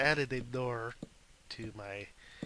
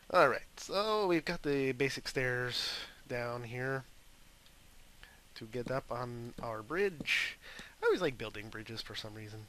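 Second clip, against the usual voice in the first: ordinary delivery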